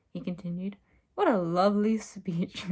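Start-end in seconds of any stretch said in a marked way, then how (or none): 1.1-2.7 mock praise with a swooping "lovely"; sarcastic. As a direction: gush it with heavy sarcasm. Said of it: "Michael said sarcastically".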